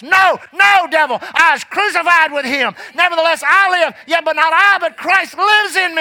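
high shouted rebuke, slowing at the end; defiant shout at the devil; rebuking the devil. Acted.

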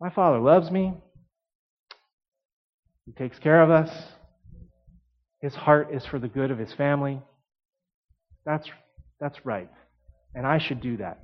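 softly, pausing between thoughts; thinking to himself. Acted. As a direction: think it over under your breath. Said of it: "he stops and he thinks".

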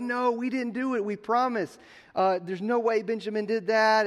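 high-pitched pleading denial, retold; acted protest. Brothers defending Benjamin against the theft charge, acted high protest. Brothers deny stealing the cup.

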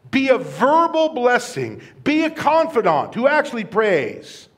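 high, loud exhortation in driving phrases; rousing preaching. Real, not acted. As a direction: lift the voice and charge the congregation. Preacher exhorting congregation with positive commands.

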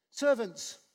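normal talking voice

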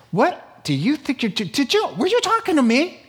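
high-pitched, hurried cocky challenge with laughs; mock tough taunt. Demon sizing up a powerless man, mock tough and cocky.

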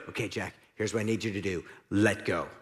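calm God voice. Acted. God gives instruction.

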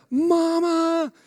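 mock toddler calling for mama; toddler crying mama. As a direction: wail it like a hurt toddler. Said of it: "he would cry out".

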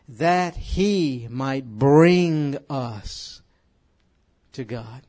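each word stretched slowly; slow and drawn out. Real, not acted. Slow emphatic prayer line.

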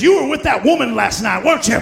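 loud, stern accusing challenge; prophet's accusation. Retold or imitated. Prophet confronting the senator, loud and accusing. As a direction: call him out on his sin. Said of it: "looks at Senator so-and-so and says".

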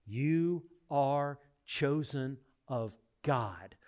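very slow, every word weighted; emphatic reading. Real, not acted. Preacher reading the verse to the church, slow and weighty. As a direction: lay out each word deliberately. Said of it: "look at what he says".

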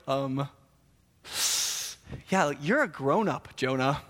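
hesitant, deadpan reply; mildly unimpressed; understated reproof. Retold or imitated. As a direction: pause awkwardly, then gently point out the obvious. God's awkward deadpan reply.

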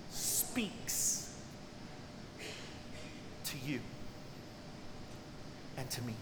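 breathy whispered word. Acted. Preacher whispering that God speaks to his hearers. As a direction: drop to a whisper on the key word.

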